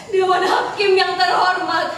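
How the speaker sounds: insistent, projected courtroom appeal. Acted. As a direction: raise your voice to demand the court's attention. Repeats the address, insisting.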